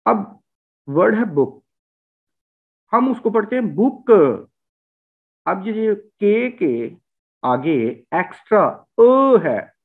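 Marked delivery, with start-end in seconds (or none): 3.7-4.1 बुक with an extra schwa after the k; Punjabi-style book. Retold or imitated. Teacher imitates how Punjabis say book. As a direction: add a little uh after बुक. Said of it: "ये हकीकत में श्वा है".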